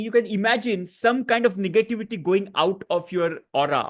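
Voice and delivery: ordinary delivery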